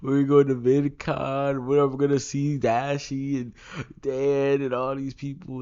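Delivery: high, loud mock fanboy excitement; imitated kid freaking out. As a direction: gush loudly as your thrilled younger self. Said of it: "be, like, freaking out".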